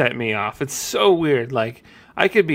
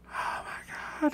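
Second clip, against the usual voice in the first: whispered despair; 14 dB quieter, slower than usual. Retold or imitated. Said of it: "I just like".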